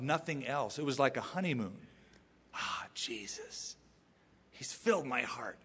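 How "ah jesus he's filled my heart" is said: breathed, soft lovestruck sigh; tender adoration. Retold or imitated. Preacher voicing a new believer in honeymoon joy, tender adoration. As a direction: begin almost whispered, low and hushed, as if in love.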